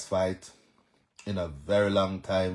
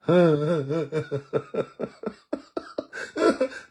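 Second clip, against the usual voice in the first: high rising laugh; 7 semitones higher than usual. Real, not acted. Laughs at a commenter's question.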